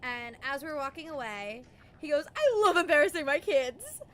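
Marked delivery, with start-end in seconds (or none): 2.3-4.0 loud, higher proud tease; gloating dad. Retold or imitated. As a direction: boast it like a cheeky father. Dad gloating while walking away.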